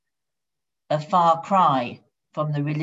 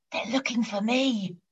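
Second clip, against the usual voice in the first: put-on higher small-child voice; little whispering child; 5 semitones higher, 4 dB quieter than usual. A narrator's reading. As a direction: confide it like a hiding kid. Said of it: "Still whispering, the little voice said".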